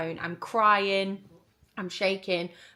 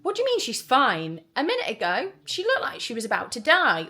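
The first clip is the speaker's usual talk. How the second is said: retold protest, louder and higher; indignant disbelief; 4 semitones higher, 5 dB louder than usual. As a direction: argue back in mock outrage. Acted indignant reply to the nurse.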